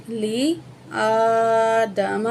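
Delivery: لِآدَمَ very slow with the aa stretched; drawn vowel. Shown as a demonstration. Teacher shows the stretched vowel to students. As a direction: pull the long a in لِآدَمَ. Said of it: "ایک الف کے برابر ہم کھینچ کے پڑھیں گے".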